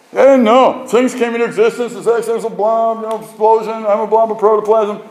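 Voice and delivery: high, loud, scoffing caricature voice; mocking skeptic. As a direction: lampoon the know-it-all who dismisses God. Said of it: "how many times we go".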